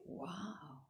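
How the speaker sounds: breathless whispered wow; awed whisper. Retold or imitated. Buddha nature marveling at the punk, hushed whisper. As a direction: whisper it in quiet wonder. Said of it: "to say".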